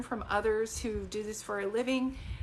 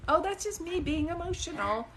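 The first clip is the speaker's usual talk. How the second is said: mock self-doubt; 7 semitones higher than usual. Mimicking her own self-doubt.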